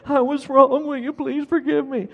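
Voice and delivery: put-on high little-kid voice parroting an apology; high, dutiful child imitation. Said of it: "he dutifully responded".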